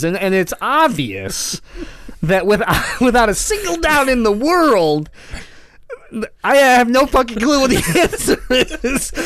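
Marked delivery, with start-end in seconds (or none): 2.2-9.1 high, loud laughing admission. Real, not acted. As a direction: crack up mid-sentence. Confident buildup collapsing into laughter.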